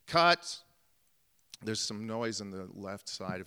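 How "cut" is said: sudden loud call of cut; shouted cut. Retold or imitated. Director yelling cut to stop the take. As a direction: bark it like a director stopping the take.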